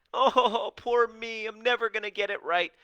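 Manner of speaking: high, loud whiny moan with a laugh; a hammed-up, laughing poor-me whine; mock self-pity. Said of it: "And you have this".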